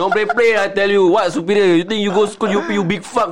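shouting in anger; angry. Real, not acted. Ranting at people who bully others.

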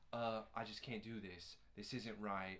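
low, very soft mumbled impression; subdued, mock-hesitant quote; ambiguous, hesitant boyfriend. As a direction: mimic him backing out, soft and low. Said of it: "so ambiguous on everything where he's just like".

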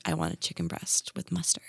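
very soft, half-whispered magician imitation; hushed insistence. Magician insisting on his order to the waitress, hushed. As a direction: repeat the order in a solemn hush.

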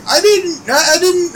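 a sudden high-pitched flustered stammer. Retold or imitated. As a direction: jump way up, sputter the denial. Wife's retold stammer.

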